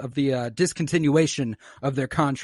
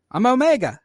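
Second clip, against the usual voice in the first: higher-pitched, lightly voiced girl clone; Omega introducing herself; 6 semitones higher, 3 dB louder than usual. Omega introduces herself to confused clones, high light voice. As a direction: introduce yourself in a raised voice. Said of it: "She's like".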